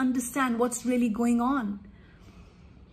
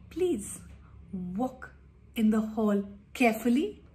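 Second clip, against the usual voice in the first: much softer, calm please; gentle request; 4 semitones lower, 6 dB quieter than usual. Shown as a demonstration. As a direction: coax the child quietly.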